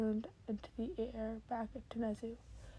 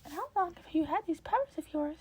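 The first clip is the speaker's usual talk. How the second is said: higher, louder eager questioning; Nezu's voice; 8 semitones higher, 4 dB louder than usual. Acted. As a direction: ask eagerly, words tumbling out. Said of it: "the words tumbling faster".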